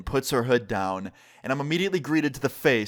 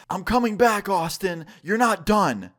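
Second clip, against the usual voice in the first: mild mock scream, higher pitched; retold scream; 6 semitones higher, slower than usual. Ex screaming while running away, mild mock scream. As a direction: call it out as an acted parting threat. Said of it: "she screams out".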